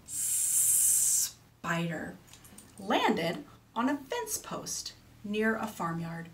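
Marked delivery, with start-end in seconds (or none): 0.1-2.2 SPIDER opening on a very long hissed S; long snake sound. Shown as a demonstration. Teacher has children repeat the word, long snake S. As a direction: hold the S of spider, then release the rest. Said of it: "It has our snake sound".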